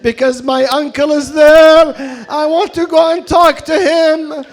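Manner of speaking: pleading cry rising into lament; impassioned preaching. Acted. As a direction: preach at full force, building to the climax. Sermon climax, voice rising.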